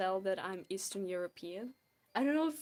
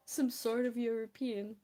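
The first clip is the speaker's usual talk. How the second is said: normal talking voice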